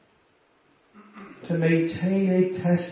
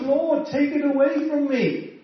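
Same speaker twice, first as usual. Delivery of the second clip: begging Paul; 9 semitones higher than usual. Retold or imitated. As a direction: cry out to God to take it away.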